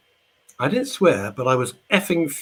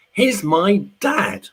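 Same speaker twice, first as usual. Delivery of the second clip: mock squeak; 9 semitones higher, 6 dB louder, much slower than usual. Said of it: "I'm crimson and half cry and I half squeak".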